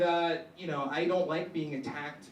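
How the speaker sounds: usual speaking voice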